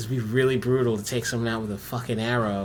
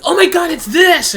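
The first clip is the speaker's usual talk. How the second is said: loud, high-pitched exasperated yell; mock screaming; 12 dB louder than usual. Imitating viewers yelling at clueless players, mock scream. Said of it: "must be screaming".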